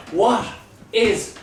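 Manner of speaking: mock mother, one word at a time; slow word-by-word imitation. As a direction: imitate a mother spelling it out very slowly. Mother mocking his slowed speech.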